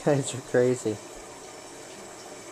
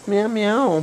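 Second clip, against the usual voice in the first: louder, higher mock meows; playful cat imitation; 5 semitones higher, 5 dB louder than usual. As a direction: meow back at the cat. Meowing back at the cats.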